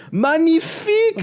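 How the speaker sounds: very high, loud, slow French exclamation; French accent. Retold or imitated. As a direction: gush it like a flamboyant Frenchman. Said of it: "Jacint is like".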